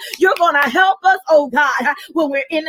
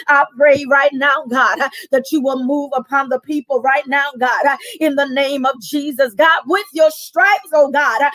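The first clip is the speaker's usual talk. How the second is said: usual speaking voice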